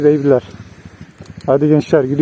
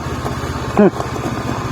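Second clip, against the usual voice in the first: breathy laugh; 4 semitones higher, 7 dB quieter than usual. Real, not acted. Laugh after the hard ride.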